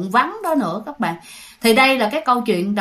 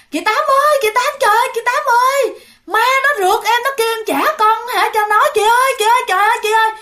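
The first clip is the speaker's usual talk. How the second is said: high, loud panicked crying out; young mother screaming to the neighbor that a ghost chased her; terrified pleading; 11 semitones higher, 3 dB louder, faster than usual. Retold or imitated. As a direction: beg for help, scared out of her wits. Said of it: "nghe kêu".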